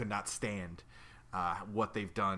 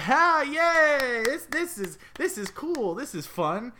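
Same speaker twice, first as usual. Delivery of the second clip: high, loud excited office workers; excited cheer; 10 dB louder, slower than usual. Retold or imitated. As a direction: gush with childish delight. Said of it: "They were like".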